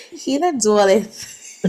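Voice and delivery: slow, tentative try at an American accent; attempted American accent. Shown as a demonstration. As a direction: test out an American accent, amused. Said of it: "do I have a good American accent".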